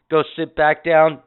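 normal talking voice

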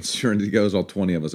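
ordinary talk

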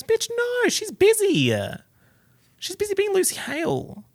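very high squeaky comeback; mock scolding. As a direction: squeal it in playful exasperation. Mock comeback to viewers' question.

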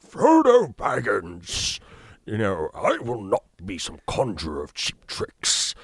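big drawn-out British wizard theatrics; Gandalf. Shown as a demonstration. Demonstrates how the British actor acts, grandly theatrical. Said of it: "when he starts acting".

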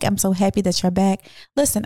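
ordinary talk

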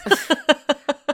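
a big shrieking laugh; loud high laugh. Real, not acted. Laughing at herself.

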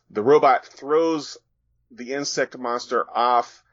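normal talking voice

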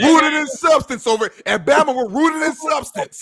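high, slow, loud proclamation; mock slogan. Mock slogan after the joke.

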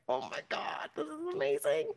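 very soft, breathy awed aside; hushed awe. Retold or imitated. Fan snuggled by the idol, hushed awe. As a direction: gasp it softly to yourself, amazed. Awestruck reaction to being snuggled.

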